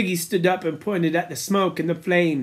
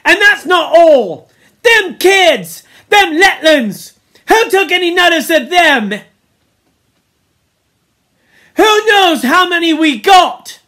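high, loud accusing shout; Piggy's angry tirade; 11 semitones higher, 9 dB louder than usual. A narrator's reading. Piggy demands who watched the little kids, angry shouting. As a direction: hurl accusations at the whole group. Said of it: "listening to his tirade".

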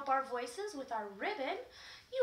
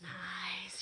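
soft whispered nice; whispered praise; 6 semitones lower, 5 dB quieter than usual. Real, not acted. Teacher whispering praise to the class after their turn. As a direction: breathe the praise gently.

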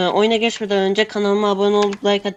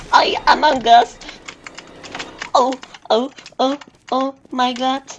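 panicked yelp; 6 semitones higher, 4 dB louder than usual. Real, not acted. Panics at chasing Among Us.

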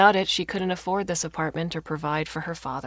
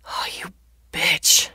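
slow, louder snap of anger; angry snap; 7 dB louder, slower than usual. A narrator's reading. Father to his daughter who unplugged the TV, angry snap. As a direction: snap it out slowly and forcefully. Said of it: "her father snapped".

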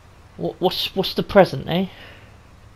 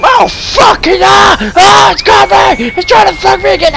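shouting; 7 semitones higher, 7 dB louder than usual. Real, not acted. Attacked by ghost, shouting.

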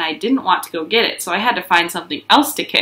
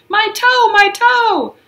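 very high, louder wailing cries; hollering; 14 semitones higher, 4 dB louder, much slower than usual. Acted. Junie rolling in the grass, hollering. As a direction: wail like a hurt kid. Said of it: "I hollered".

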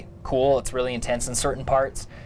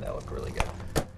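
deep, breathy whisper; low whispered echo; 12 semitones lower, 7 dB quieter than usual. Pretend. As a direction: murmur it back low and hushed. Co-host echoes the line.

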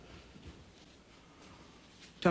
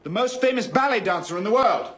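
emphatic loud repeat; 5 dB louder than usual. Acted. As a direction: raise your voice for someone who missed it. Repeats after listener says Pardon.

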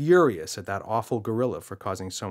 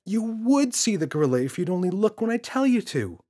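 put-on higher, louder kid voice insisting it's real; a frustrated boy pleading to be believed; insistent boy; 11 semitones higher, 5 dB louder than usual. James voiced in story.

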